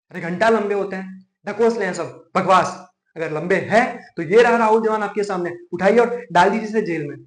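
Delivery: loud, scornful courtroom tirade; angry outburst. Acted. Lawyer challenging the judge to jail the accused, angry outburst. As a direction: explode at the court, hammering each phrase.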